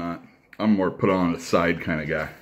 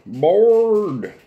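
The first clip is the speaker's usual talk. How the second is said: cartoon voice; 11 semitones higher, 4 dB louder than usual. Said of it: "it's got the voices of the characters down".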